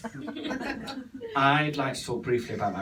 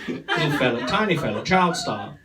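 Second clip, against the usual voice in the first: usual speaking voice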